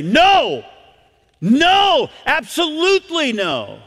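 high, ringing NO, then "absolutely no"; emphatic no. Acted. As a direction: refuse outright, hammering each NO. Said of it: "where you would say".

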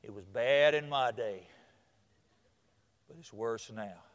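gloomy preacher. Retold or imitated. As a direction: drag it out low and grave, like a doomsaying preacher. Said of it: "We preachers get up sometimes".

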